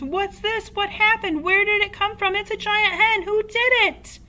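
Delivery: high, loud, rapid-fire excited questions; frantic excitement. A narrator's reading. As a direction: jump about firing questions in a frenzy. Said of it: "he cried, waving his arms".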